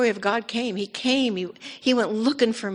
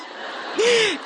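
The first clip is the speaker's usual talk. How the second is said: high burst of laughter. Real, not acted. Laughs after her own answer.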